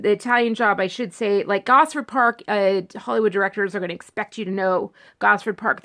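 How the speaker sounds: usual speaking voice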